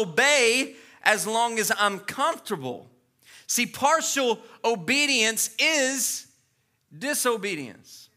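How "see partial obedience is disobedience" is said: slow, weighty declaration; slow emphasis. Real, not acted. Preacher to congregation, slow and weighty. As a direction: let each word land. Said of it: "slow emphatic point".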